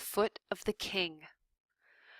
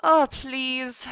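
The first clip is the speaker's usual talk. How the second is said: higher, loud scorn; exasperated; 7 semitones higher, 8 dB louder than usual. A narrator's reading. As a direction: wave him off, fed up. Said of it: "said Antoinette".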